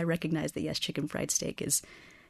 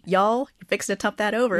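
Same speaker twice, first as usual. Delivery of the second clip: higher and louder mock scold, "Y'all" in front; hammed-up Texas warning; 6 semitones higher, 7 dB louder than usual. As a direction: warn a kid playfully, Texas style. Host voices the ultimate Texas warning.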